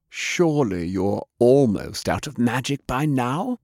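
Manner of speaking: a touch of a sneering hiss; lightly hissed taunt; mild hiss. Acted. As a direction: ninja taunting her worn-out rival, mildly hissed. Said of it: "Toshiko hissed".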